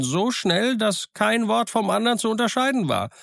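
high, rambling castaway, half pious, half sly; a marooned sailor swearing he's reformed. Acted. Castaway tells of pious mother.

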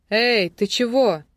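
menacing call. A narrator's reading. Newcomer calls out to the captor, menacing shout. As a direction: call out sternly, drawing it out. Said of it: "услышал он грозный окрик".

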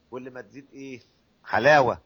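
usual speaking voice

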